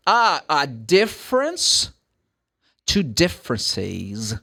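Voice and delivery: slow, "differences" said with the extra syllable "-es" drawn out; extra syllable demo. Shown as a demonstration. Teacher showing students the extra syllable in "differences", very slow. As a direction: stretch "differences" so the added syllable "-ez" is heard. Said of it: "você tem uma sílaba a mais".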